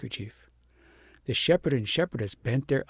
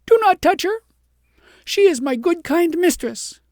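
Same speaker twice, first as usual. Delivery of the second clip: much higher, louder; desperate cry; 9 dB louder than usual. A narrator's reading. Girl shields her mistress from the soldiers, high and loud. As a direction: throw yourself between them.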